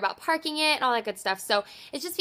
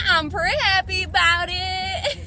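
laughing; 6 semitones higher, slower than usual. Real, not acted. Laughing with joy over score.

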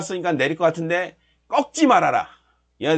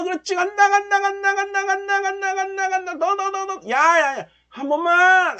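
high, rapid-fire urging; frantic cheering; 10 semitones higher than usual. Real, not acted. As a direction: chant the chart higher. Profits peaking, frantic cheering.

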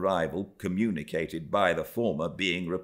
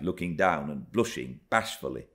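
ordinary delivery

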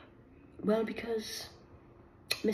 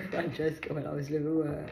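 ordinary delivery

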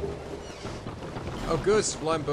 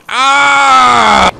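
long, loud high yell; drawn-out scream of fury; frustrated scream; 10 semitones higher, 10 dB louder than usual. Real, not acted. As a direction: scream out the rage.

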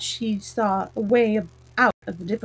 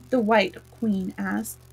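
usual speaking voice

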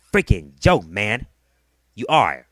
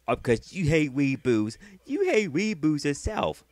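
slow, higher sneering imitation of the rival; mocking taunt; 5 semitones higher than usual. Taunting OCP about reboots, slow and sneering. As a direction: drag it out to mock him.

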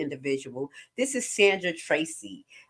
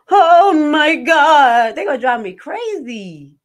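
loud, drawn-out exasperated cry; loud stressed-out moan about family; exasperated wail; 9 semitones higher, 11 dB louder, slower than usual. Real, not acted. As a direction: wail it like family is driving you crazy. Stressed about Haitian family visit.

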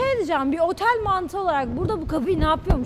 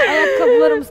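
high, loud alarmed cry; alarmed shout; 10 semitones higher, 10 dB louder, slower than usual. Real, not acted. Alarmed outburst.

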